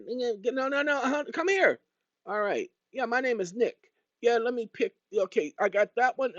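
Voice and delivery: high, insistent nitpicker calling you over; pushy nitpicker. Acted. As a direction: badger them like an eager picker. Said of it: "Man always comes up with this".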